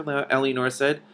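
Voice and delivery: ordinary delivery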